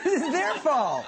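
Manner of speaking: high, slow, mocking indignation; 1 mock indignant. Instructor mocking a driver who blames the pedestrian, high and slow. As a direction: drag out the blame sarcastically. Sarcastic comment on claim.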